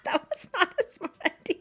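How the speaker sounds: giggling through the words; real laughter spilling into speech; laughing. Real, not acted. To her viewers, laughing at her own blush mistake.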